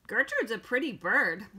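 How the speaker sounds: lightly screeched parrot talk; a mild squawk, like a talking bird; parrot scream. A narrator's reading. Parrot pipes up about herself, mild scream. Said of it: "screamed Gertrude".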